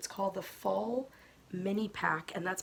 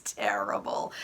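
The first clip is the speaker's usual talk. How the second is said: low laughing repeat of the word; 10 semitones lower, 4 dB louder, slower than usual. Real, not acted. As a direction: laugh it out, amused at yourself. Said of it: "I am terrible at keeping secrets".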